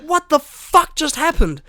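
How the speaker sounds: high, slow, loud panicked outcry; freaking out. Retold or imitated. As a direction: replay the shock of a rude awakening. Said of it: "I was freaking the fuck out".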